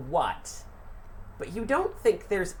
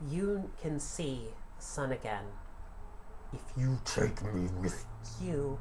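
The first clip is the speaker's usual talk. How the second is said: soft sibilant spirit whisper; hissing serpent dragon; 3 semitones lower, 6 dB quieter than usual. Acted. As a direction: hiss the words like a spirit. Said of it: "in a sibilant voice".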